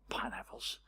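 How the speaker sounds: hushed, voiceless joking whisper; retold whisper. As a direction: whisper it like a private joke to your son. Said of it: "just whispered to my older brother".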